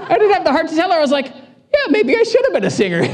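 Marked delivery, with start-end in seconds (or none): 1.3-3.0 high, laughing sheepish agreement; awkward laughing reply. Retold or imitated. Pastor to churchgoer lady, high sheepish laughing reply. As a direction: chuckle it out, embarrassed.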